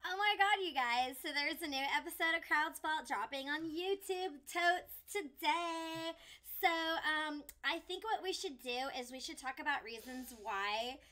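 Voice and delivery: put-on bubbly, loud valley girl. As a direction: gush it like a ditzy valley girl. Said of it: "Do your Valley Girl voice".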